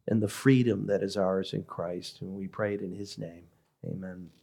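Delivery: soft, reverent closing prayer. Acted. Pastor closing prayer before the sermon, reverent and soft. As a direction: pray it quietly to the Lord.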